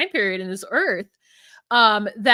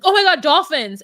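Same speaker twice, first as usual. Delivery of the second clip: quick loud burst of fake delight; sudden mock excitement; 5 semitones higher, 8 dB louder than usual. Boyfriend spotting dolphins, loud mock excitement. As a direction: jump on the word dolphins with mock glee. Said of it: "he's like".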